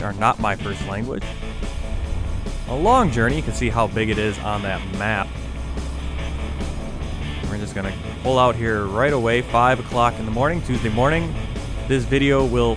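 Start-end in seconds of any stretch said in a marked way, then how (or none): none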